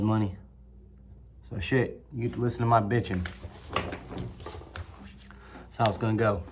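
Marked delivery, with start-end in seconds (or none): 3.7-6.4 muttered aside. Real, not acted.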